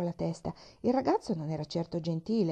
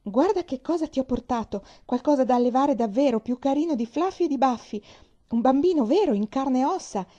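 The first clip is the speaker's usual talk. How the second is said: much higher and louder, showing off her find; triumphant; 6 semitones higher, 7 dB louder than usual. Acted. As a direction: present him like a treasure. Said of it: "disse trionfante". (trionfante, molto più acuta e forte)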